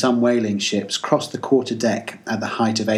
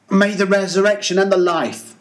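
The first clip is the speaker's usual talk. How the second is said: much higher, raised solemn prayer; fervent burial prayer; 9 semitones higher, 3 dB louder than usual. Acted. Captain prays over the body, raised fervent voice. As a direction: lift the prayer over the sea.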